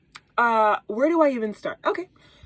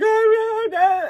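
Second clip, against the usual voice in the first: high, loud mock wail; imitated child crying; 10 semitones higher, 7 dB louder, slower than usual. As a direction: wail like a terrified little boy. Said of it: "he was already crying".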